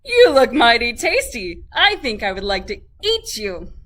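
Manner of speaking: loud, hearty horse voice; hungry horse. A narrator's reading. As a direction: boom it out greedily. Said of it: "said the horse".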